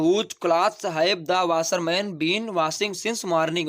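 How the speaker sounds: very slow, word by word; dictating slowly. Shown as a demonstration. Teacher dictates the answer to students, very slowly. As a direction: spell it out for the class. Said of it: "teacher dictating the English answer".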